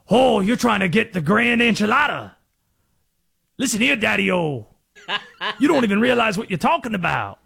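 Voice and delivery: lightly put-on Southern hipster voice; Southern accent. Host demonstrating the Conspiracy Josh voice, a touch of Southern accent. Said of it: "John Candy and JFK with shades on saying".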